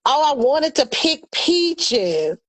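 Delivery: slow, drawn-out wistful mock; hammed-up dreamy reminiscence; mocking mama. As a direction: stretch it out, teasing her nostalgia. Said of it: "here we go".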